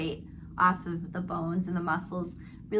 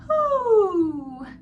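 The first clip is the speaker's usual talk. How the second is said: high falling head-voice hoo; loud, high owl call; owl hoot; 10 semitones higher, 9 dB louder than usual. Shown as a demonstration. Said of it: "making a sound like an owl".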